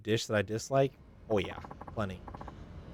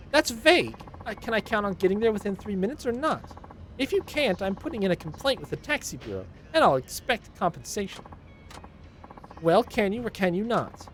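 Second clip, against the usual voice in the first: higher, scolding complaint; nagging Karen; 11 semitones higher than usual. Acted. Karen passenger scolding the taxi driver, higher nagging voice. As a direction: nag the driver for being late. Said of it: "passengers that complains about everything".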